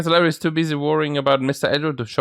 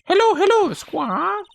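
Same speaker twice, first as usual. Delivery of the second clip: parrot; slower than usual. Acted. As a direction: squawk it out like a parrot. Voicing the parrot Polly.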